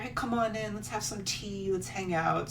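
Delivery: high, soft mock-innocent invite; sweet fake welcome. Hosts luring travelers inside, high sweet voice.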